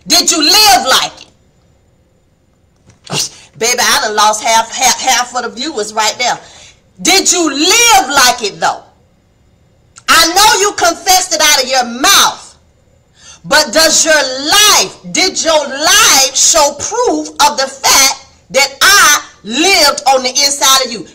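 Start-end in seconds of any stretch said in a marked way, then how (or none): 0.1-1.3 loud, high-pitched challenge; Jesus questioning sternly. Acted. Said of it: "he's gonna say". 7.0-21.1 loud, slow, drawn-out cross-examination; a judge pressing for proof; Jesus questioning sternly. Acted. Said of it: "he's gonna say".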